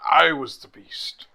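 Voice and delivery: proud boast. Acted. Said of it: "I wanna be like".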